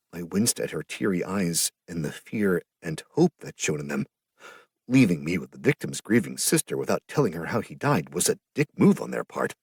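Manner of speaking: usual speaking voice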